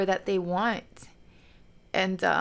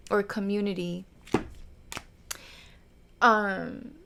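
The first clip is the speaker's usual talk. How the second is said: usual speaking voice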